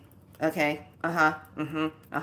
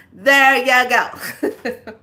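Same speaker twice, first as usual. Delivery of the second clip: laughing; 6 semitones higher, 9 dB louder, much slower than usual. Real, not acted. Repeats punchline, laughing.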